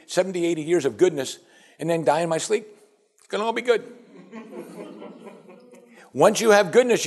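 high, breezy mock-naive voice; carefree complacency. Preacher mimicking a complacent believer, high and breezy. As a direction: play the smug believer sure life stays good. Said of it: "what you do and I do".

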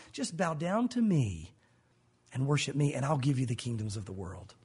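tempting Satan. Acted. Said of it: "when Satan came".

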